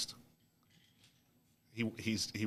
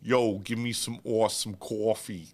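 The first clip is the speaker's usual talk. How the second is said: louder, hammed-up New York accent; 3 semitones higher, 5 dB louder than usual. Shown as a demonstration. Host showing his UK friend how to order, New York accent. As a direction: lay on a New Yorker's coffee order. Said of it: "trying to fit in in New York".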